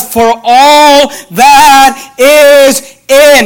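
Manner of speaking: "all" stretched long and loud, each following word held through "in"; hugely drawn-out "all", deliberate weight on "in"; stress on all and in. Shown as a demonstration. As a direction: hammer "all" and "in", very slow, word by word. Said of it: "underline that word "all".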